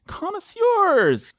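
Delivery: high, drawn-out flourish; slow, hammed-up high announcement; grand introduction. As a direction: stretch the word up high, mock-fancy.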